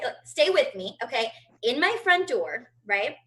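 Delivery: usual speaking voice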